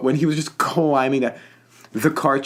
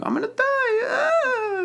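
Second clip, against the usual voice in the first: very high, squeaky, panicked wail; exaggerated falsetto death cry; mock Tom Hardy dying; slower than usual. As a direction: squeal it like a dying man, hammed up. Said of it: "Tom Hardy going".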